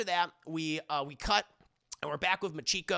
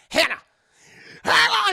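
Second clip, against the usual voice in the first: mother screaming for Hannah, loud and much higher; retold scream; 14 dB louder than usual. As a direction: yell the name down the hall, louder the second time. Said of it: "screaming for Hannah".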